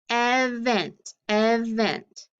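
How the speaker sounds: "event" broken as EV-ent; short E split. Shown as a demonstration. Teacher trying the other division for students, short-E "event". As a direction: say "event" with a short first e, EV-vent. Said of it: "tried it on the other side".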